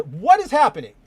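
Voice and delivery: startled outburst at the page; real surprise. Real, not acted. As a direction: caught off guard by the screen. Page jumps unexpectedly while scrolling.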